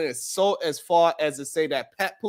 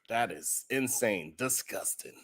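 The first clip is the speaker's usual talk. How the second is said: whispered disgust; 6 semitones lower, 10 dB quieter than usual. Real, not acted. Disgusted reaction.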